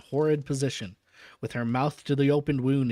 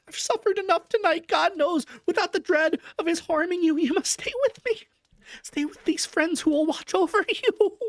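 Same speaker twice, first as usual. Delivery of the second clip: much higher woman's voice, pleading; cling to him and beg him to stay; frantic Mina. A narrator's reading. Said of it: "she seized hold of him and cried out".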